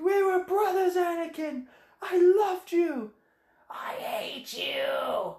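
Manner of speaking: hammed-up heartbroken Obi-Wan; mock grief fading to a whisper; anguished whisper. Obi-Wan's anguish quoted.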